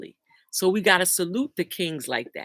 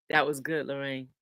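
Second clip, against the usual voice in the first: ordinary delivery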